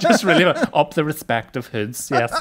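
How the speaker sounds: slow, amused mock-polite correction; overly polite parody. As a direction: say it with exaggerated courtesy, half laughing. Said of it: "overly polite about things".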